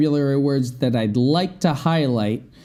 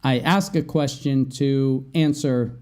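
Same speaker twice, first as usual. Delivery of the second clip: ordinary talk, nothing marked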